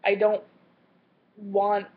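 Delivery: normal talking voice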